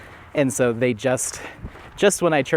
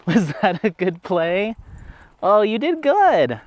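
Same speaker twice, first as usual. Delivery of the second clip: high, slow sing-song praise; 7 semitones higher, slower than usual. Real, not acted. Owner praising her dog after play, high slow baby talk. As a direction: coo at the dog slowly, drawn out.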